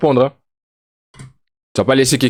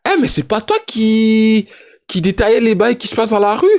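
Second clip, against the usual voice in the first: mock accuser; 10 semitones higher than usual.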